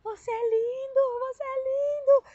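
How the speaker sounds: high, tearful cry of wonder; awed adoration. Retold or imitated. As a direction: re-enact marvelling at someone, voice way up high. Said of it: "eu falava assim". (admiração extasiada, voz aguda e chorosa)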